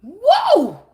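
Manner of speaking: loud startled whoa; a big hammed-up gasp of shock; exaggerated loud whoa at the ring; mock surprise. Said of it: "You say".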